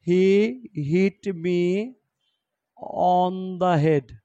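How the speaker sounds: very slow, spaced-out dictation. Shown as a demonstration. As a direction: dictate it word by word. Teacher dictating example sentence. (ধীরে ধীরে শ্রুতলিপি)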